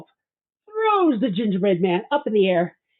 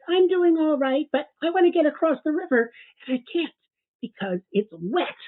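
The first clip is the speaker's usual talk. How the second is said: worried gingerbread man; 9 semitones higher than usual. Acted. Gingerbread man telling the wolf he can't cross, high worried voice. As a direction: sound small and anxious about getting wet.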